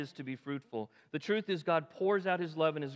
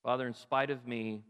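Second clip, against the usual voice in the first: closing prayer; 4 semitones lower than usual. Acted. Pastor leads closing prayer.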